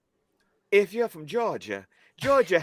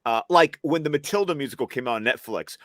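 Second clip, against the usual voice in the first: ordinary talk, nothing marked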